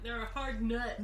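punchline joke. Retold or imitated. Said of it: "I'm like".

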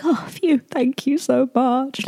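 high, tearful imitation of her own thanks; mock sobbing thanks. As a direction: play back her teary relief, lightly. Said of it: "Literally sobbing through the tears".

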